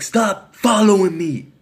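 slow retold holler; imitated yell. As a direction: imitate a man calling out, stretching the words. Acts out the dude's cry.

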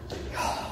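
heavy winded exhale; breathless sigh. Real, not acted. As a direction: puff out a tired sigh. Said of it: "I'm out of breath".

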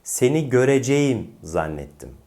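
"göreceğim" as a full gö-re-ce-yim; wrong as-spelled pronunciation. Shown as a demonstration. Teacher shows the mistake, "göreceğim" as spelled. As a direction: separate every syllable of "göreceğim". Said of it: "Yanlış olan telaffuz ne?".

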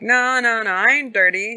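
put-on the old-timer waving off the accusation; old prospector voice. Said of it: "the old dude's like".

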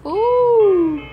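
high loud startled ooh; startled cry. Real, not acted. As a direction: react to a jump scare. Jump scare in horror game.

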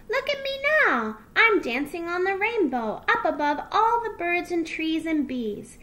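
high, loud little fox showing off; gleeful delight. A narrator's reading. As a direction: call out joyfully while dancing. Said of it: "laughed Little Fox".

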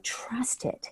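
soft whispered trust it; whispered aside. Real, not acted. To listeners on following their interest, whispered. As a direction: drop to a breathy whisper, confiding.

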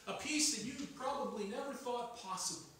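ordinary delivery

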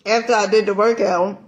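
usual speaking voice

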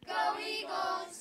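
high, loud, drawn-out cheer; cheer shout. Real, not acted. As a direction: yell the school cheer together. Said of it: "Go Eagles on three".

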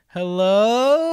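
a high, loud "Hello?" into the men's room; calling out. Retold or imitated. Store clerk calling into the men's restroom, calling out. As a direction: call out, unsure if anyone's there.

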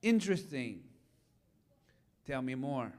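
soft, low, unhurried curiosity; curious invitation. Shown as a demonstration. Pastor opening with curiosity instead of advice, soft and low. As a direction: gently invite them to keep talking. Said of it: "I lead with".

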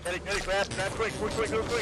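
high, rushed urgent calls; frantic urging. Real, not acted. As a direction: urge them on in a panic. Grabbing loot while chased.

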